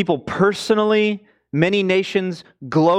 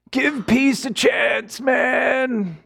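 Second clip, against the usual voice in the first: hippie voice; 6 semitones higher, much slower than usual. Retold or imitated. Mocking long-haired hippies.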